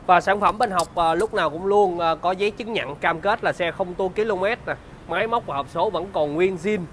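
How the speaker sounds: bright, upbeat sales pitch; slick used car salesman. Acted.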